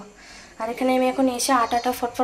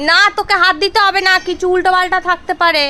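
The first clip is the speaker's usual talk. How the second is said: raised voice; 8 semitones higher, 13 dB louder than usual. Real, not acted. Stopping someone picking up debris.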